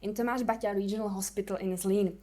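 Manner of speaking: Czech-accented English. Real, not acted. Speaker from the Czech Republic.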